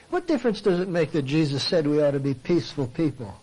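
ordinary delivery

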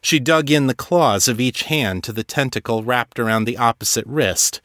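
ordinary delivery, nothing marked

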